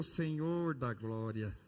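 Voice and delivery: slow weary groan of a reply; exasperated sigh. Retold or imitated. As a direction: drag it out, worn out.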